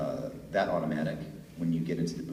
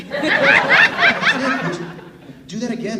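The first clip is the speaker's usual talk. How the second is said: excited producer; 16 dB louder, much slower than usual. Retold or imitated. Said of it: "And they said".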